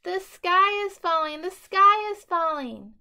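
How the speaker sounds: high, loud frantic shrieking; panicked scream. A narrator's reading. Scared chick screaming to everyone as he runs. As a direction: shriek it while running in panic. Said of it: "Dizzy Ducky screamed as they ran".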